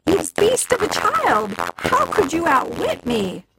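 witch calling out. A narrator's reading. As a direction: cry out in betrayed fury. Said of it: "The witch called out".

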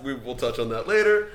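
usual speaking voice

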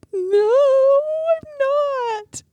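put-on high, tearful little girl's wail; crying child. Emma, crying, answers her dad in a high tearful wail. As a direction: sob it out like an upset kid. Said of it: "she's like".

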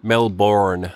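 Melbourne with a full orn ending, American style; American pronunciation. Shown as a demonstration. Mimicking Americans to her Australian cohost, Melbourne with orn. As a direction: say Melbourne the way Americans do, MEL-born. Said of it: "as Americans say it".

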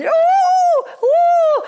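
squealing troll hoots, very high; high excited hoots. Acted. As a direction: hoot like gleeful trolls.

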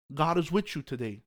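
ordinary talk

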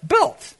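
Built with a sharp high falling stress; stressed word. Shown as a demonstration. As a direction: hit Built hard and high, then drop. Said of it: "The word behind that is built".

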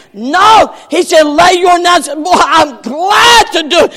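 loud, rising sermon delivery; fiery preaching. Acted. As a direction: preach it hard to the congregation. Preacher at full force.

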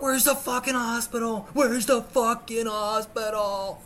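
high yell, slowing on the repeat; frantic demand. Retold or imitated. Bleeding man asking dog owner the way, frantic yelling. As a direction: holler it in panic, stretching the second one.

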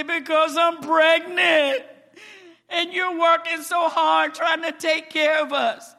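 crying out. Retold or imitated. Wife blurting to husband why she cries, loud tearful outcry. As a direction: burst out through tears. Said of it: "What are you crying for?".

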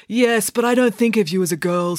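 high-pitched voice of an awkward brother; a hint of bashful boy; lightly voiced earnest boy. A narrator's reading. Story reader voices Binky.

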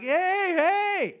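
high-pitched calling out; mock scream. Blind man hailing a passing doctor, mock scream. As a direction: yell for attention in a high, hammed-up voice. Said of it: "Just screaming like".

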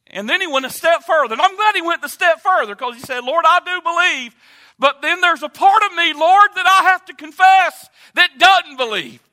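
loud, climbing preacher's cry; full-force preaching. Acted. Preacher voicing the father's confession, loud and climbing. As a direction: drive the confession home at full volume.

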